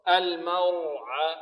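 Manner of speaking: slow chanted "المرعى" with thick r; heavy ra, light mim. Shown as a demonstration. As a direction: stretch "المرعى", heavy ra between light letters. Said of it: "الميم مرققة والراء مفخمة".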